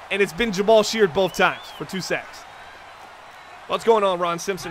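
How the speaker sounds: normal talking voice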